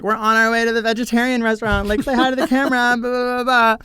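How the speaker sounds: high, sing-song vlogging voice; mock a chirpy on-camera greeting; vlogger intro. Traveler greeting the camera in the taxi, high mock vlogger voice. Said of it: "We start vlogging, like".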